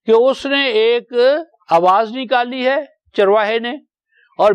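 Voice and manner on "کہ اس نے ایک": normal talking voice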